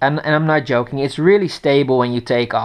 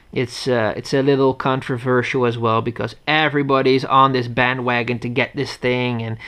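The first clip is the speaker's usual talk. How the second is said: ordinary talk, nothing marked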